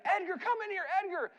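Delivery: high, urgent call for Edgar; mock a scared boy calling his big brother; yelling for his brother. Sick boy calls out to his older brother, high-pitched mock yell. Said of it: "he starts yelling for his older brother".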